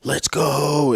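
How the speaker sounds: half-hearted copy of a celebrating athlete; imitated scream. Co-host imitating players who just scored, mild mock scream. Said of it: "they'll just scream".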